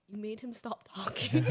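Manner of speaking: friend's line through giggles; laughing retelling. Retold or imitated. Friend reacting to the tongue-tied guy, laughingly retold. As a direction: quote it while trying not to laugh.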